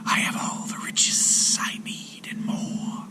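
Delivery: gentle whispered serpent; comforting serpent whisper. A narrator's reading. Serpent consoles the weeping sailor in a whisper. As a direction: soothe him in a snake's whisper. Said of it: "the serpent laughed".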